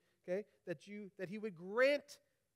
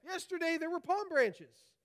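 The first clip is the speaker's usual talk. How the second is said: higher and louder protest; indignant shout; 8 semitones higher, 7 dB louder than usual. A narrator's reading. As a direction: cry out that it was different yesterday. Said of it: "he shouted".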